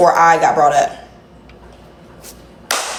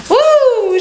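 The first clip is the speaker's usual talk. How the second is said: 1 excited woo; 14 semitones higher, 4 dB louder than usual. Real, not acted. Cheering while dancing.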